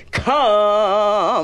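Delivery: drawn-out preacher call; preacher's call. Retold or imitated. Preacher calling to the altar.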